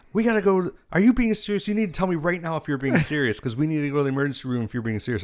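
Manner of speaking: frantic sister demanding the truth; sister freaking out. Retold or imitated. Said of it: "she's like".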